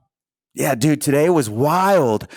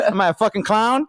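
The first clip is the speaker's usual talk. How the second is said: higher-pitched mock wiseguy, laughing; Joe Pesci; 7 semitones higher than usual. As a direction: challenge them as a parody gangster. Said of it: "Joe Pesci's coming to town".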